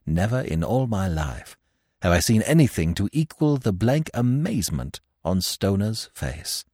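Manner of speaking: plain reading voice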